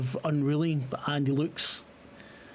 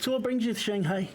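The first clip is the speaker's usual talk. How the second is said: a brisk, higher, eager host's welcome; friendly bar owner; 7 semitones higher than usual. Acted. Bar owner greeting the investigators, quick and eager. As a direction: rattle off a warm welcome to new guests.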